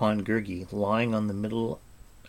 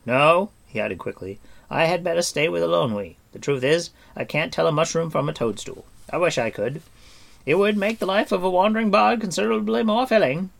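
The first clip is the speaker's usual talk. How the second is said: higher, louder jovial bard; eager bard agreeing heartily; booming jolly bard; hearty bard; 8 semitones higher, 8 dB louder than usual. A narrator's reading. Bard voiced.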